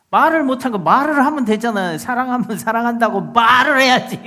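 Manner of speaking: loud, high exasperated scolding; exasperated outburst. Acted. Preacher urges people to speak their love, exasperated outburst. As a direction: scold them for keeping silent. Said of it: "이러면서 막 화를 내잖아요".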